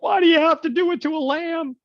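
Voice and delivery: high, loud, put-on plaintive voice; mock an upset animal lover; whiny animal lover. Animal lovers protest the lamb sacrifice, high whiny mock voice. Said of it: "animal lovers and they're like".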